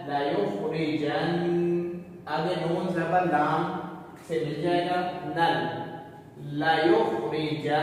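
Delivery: लयुखरिजन्नल slow, नून doubled and held nasally; nasal ghunna. Shown as a demonstration. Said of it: "नून मुशद्दद पर हमेशा गुन्ना होता है". (नून मुशद्दद पर गुन्ना के साथ)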